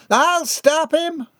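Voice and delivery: high, slow, drawn-out holler; determined holler. A narrator's reading. Missus Binkley charging after the runaway, determined holler. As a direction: holler it like a battle cry.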